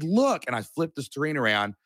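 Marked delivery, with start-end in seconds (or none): 0.0-0.4 excited look. Retold or imitated. Showing wife the ad money.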